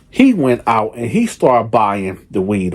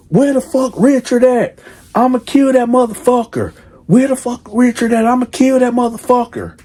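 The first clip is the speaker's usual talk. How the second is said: uncensored, much higher raised voice; mock furious father; 11 semitones higher than usual. Father calling the mother about their pregnant daughter, mock furious uncut rant. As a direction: say it the way the dad really did. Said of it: "I'ma say it the way that he said".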